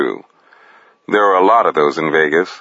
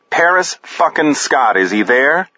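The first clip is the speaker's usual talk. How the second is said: spat out, pitch jumping high on the curse; impatient, biting phone demand; exasperated demand; 7 semitones higher than usual. A narrator's reading. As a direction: lose patience with the clerk. Bryce loses patience on phone.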